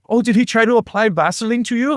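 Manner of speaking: put-on German accent. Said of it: "He's like".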